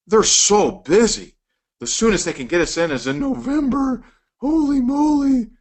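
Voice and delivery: high, amazed "holy moly"; impressed surprise. Retold or imitated. Patient amazed the doctor is booked for months, surprised. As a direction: marvel at how booked they are. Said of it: "'Cause you're thinking".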